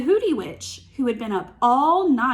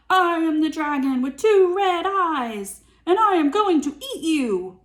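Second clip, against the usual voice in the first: loud, high mock dragon threat; hammed-up dragon boast; dragon roar; 6 semitones higher, 6 dB louder than usual.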